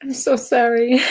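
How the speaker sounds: tearful, high voice breaking into apology; real crying. Real, not acted. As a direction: apologise through sudden tears. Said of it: "I don't know why I'm crying".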